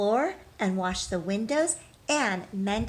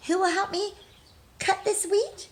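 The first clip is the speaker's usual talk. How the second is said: hen asking; 11 semitones higher than usual. Acted. Hen asks in the story.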